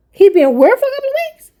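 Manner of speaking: high, incredulous rising question; can't believe what she's hearing; disbelieving. Real, not acted. Mother hearing where her son has been, incredulous. Shocked at son's absence.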